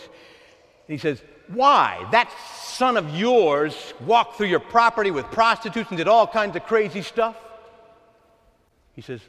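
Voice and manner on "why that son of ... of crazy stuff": loud, high, outraged protest; indignant elder son. Acted. Elder son protesting to his father, loud and indignant. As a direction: throw the brother's sins in his face.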